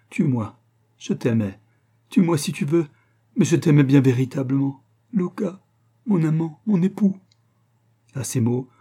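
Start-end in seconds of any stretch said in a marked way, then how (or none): 0.1-7.2 pleading, rising to a higher pitch; tearful plea. A narrator's reading. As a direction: implore your husband with growing passion. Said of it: "ses beaux yeux pleins de larmes". (supplication éplorée, de plus en plus aiguë)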